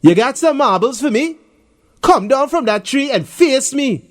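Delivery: challenging. Acted. Bully challenges Darren.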